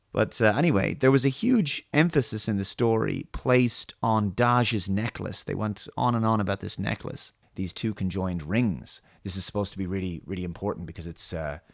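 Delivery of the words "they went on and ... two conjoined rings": ordinary talk, nothing marked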